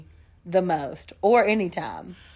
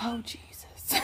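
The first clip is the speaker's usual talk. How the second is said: slow hushed whisper; 2 semitones higher, 14 dB quieter, slower than usual. Real, not acted. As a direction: breathe it under your breath. Aside after seeing profile.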